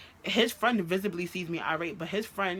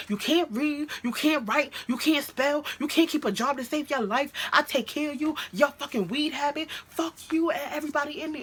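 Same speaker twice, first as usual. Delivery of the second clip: high, heated rapid-fire tirade; going off; 8 semitones higher than usual. Retold or imitated. Girlfriend reenacting her tirade at boyfriend, going off. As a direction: pile up insults in a raised voice. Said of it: "I went off".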